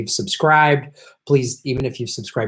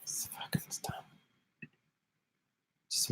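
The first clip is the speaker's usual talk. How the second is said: slow under-the-breath whisper; fed-up whisper; whispered aside; 21 dB quieter, slower than usual. Real, not acted. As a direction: hiss it to yourself. Muttered aside while streaming.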